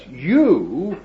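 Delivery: slow, weighty reading of the verse; scripture reading. Real, not acted. Preacher reading Ephesians aloud to the congregation, slow and solemn. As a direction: lean on each word like holy writ. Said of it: "this is what it says".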